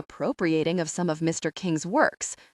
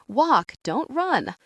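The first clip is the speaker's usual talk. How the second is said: slow, higher sing-out warning; chiming call; 6 semitones higher, much slower than usual. A narrator's reading. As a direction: chime it out slowly like a counsellors' chorus. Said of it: "chime in unison".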